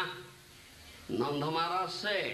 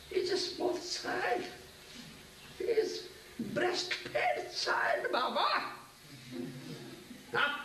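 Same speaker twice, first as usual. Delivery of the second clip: put-on much higher, tender voice for the baby boy; doting father; 10 semitones higher, slower than usual. Father Nanda fondly tells Uddhava his son is a baby, high tender voice. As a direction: coo it as a fond father would.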